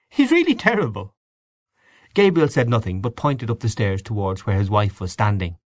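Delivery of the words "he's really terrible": much higher, animated character voice; lively female character. A narrator's reading. Mary Jane going on about Freddy, animated high voice. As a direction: exclaim it high, with lively swoops.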